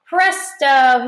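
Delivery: put-on boy revealing his slide with a shout. A narrator's reading. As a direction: announce it like a magic trick. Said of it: "he shouted".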